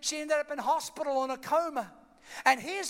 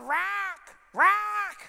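urgent trainer shouting him on; raised, higher voice urging a fighter; coach Mickey; 7 semitones higher than usual. Acted. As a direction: holler like a boxing coach. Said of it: "his coach Mickey is going".

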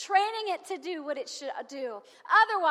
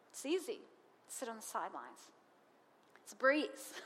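much softer, lower, dropping off; hushed refrain; 4 semitones lower, 7 dB quieter than usual. Acted. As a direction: let the refrain fall to a near murmur. Quiet repeat of refrain.

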